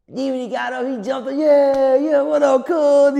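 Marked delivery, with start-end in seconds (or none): 1.4-3.2 hyped greeting. Retold or imitated. The dancer jumping up, greeting.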